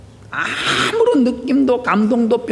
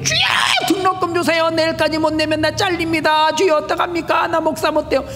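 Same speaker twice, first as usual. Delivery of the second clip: low-level begging prayer; 7 semitones higher than usual. Retold or imitated. Seminary student praying all night for fees, shouting high. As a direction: cry out to God like a panicking student. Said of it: "기도를 그렇게 수준 낮게".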